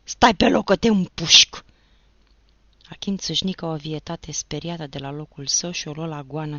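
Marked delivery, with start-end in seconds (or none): 0.0-1.6 fierce threat. Acted. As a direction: stop him dead with a harsh threat. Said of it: "urmat de un glas crunt și amenințător". (amenințare strigată, crâncenă, lentă)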